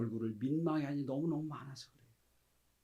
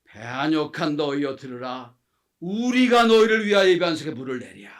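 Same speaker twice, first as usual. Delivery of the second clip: furious proclamation, building in volume; angry Moses; 14 dB louder than usual. Acted. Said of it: "너무 화가 나는 거예요".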